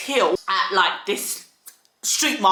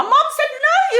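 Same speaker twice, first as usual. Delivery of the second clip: laughing; 4 dB louder than usual. Real, not acted. Joking about being sold off.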